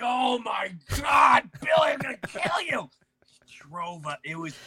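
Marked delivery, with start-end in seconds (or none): 0.0-2.9 high, loud, exasperated threat; mock fury. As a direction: yell at the friend in playful rage. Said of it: "I'm like".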